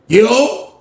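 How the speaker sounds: high rising "yo"; calling out. Retold or imitated. Answering a knock at the cell, high call-out. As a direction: call it up high toward the door.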